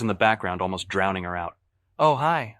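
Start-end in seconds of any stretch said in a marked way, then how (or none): none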